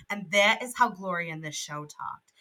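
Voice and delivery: ordinary delivery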